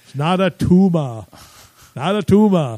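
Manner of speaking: put-on Austrian-accented movie-star voice; a light Austrian accent send-up; Arnold impression again. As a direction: say it like Arnold in Kindergarten Cop. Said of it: "the greatest Arnold quotes".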